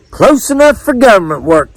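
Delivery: high carnival caller voice; fair caller. A narrator's reading. Caller letting a bad guess pass, high barker voice. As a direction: pitch it up like a barker.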